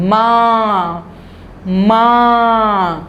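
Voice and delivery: "माँ" drawn out slowly, nasal vowel only; slow nasal māñ. Shown as a demonstration. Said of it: "आ की आवाज़ आती है".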